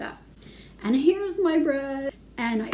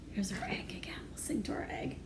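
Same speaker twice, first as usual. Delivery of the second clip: whisper; 2 semitones lower, 12 dB quieter, faster than usual. Real, not acted.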